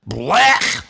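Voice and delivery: a loud disgusted blech. Acted. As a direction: spit out a loud yuck, like your stomach turned. Disgust at mean words.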